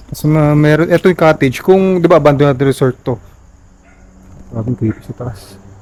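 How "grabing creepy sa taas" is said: hushed. Real, not acted. Spooked looking upstairs.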